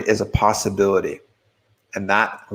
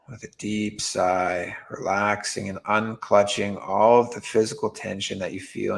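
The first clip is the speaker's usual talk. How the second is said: guided meditation; 5 dB quieter than usual. Real, not acted. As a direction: guide them gently into relaxing, with long pauses.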